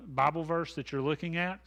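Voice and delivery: usual speaking voice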